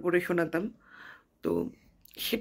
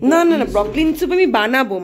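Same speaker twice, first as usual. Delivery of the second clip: loud parental no-no-no; firm scolding; 5 semitones higher, 10 dB louder than usual. Real, not acted. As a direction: stop her firmly, loud. Scolding child over broccoli soup.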